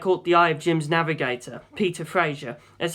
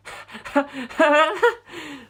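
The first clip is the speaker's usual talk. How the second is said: high burst of relieved laughing; relieved laughter; 9 semitones higher than usual. A narrator's reading. Pilots laughing in relief after the crash, laughter. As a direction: laugh off the tension. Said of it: "both broke up laughing".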